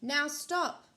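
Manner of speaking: high loud command; Max commanding. Acted. Max ordering the wild things to stop, loud and high. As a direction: stop the rumpus with a snap. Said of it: "Max said".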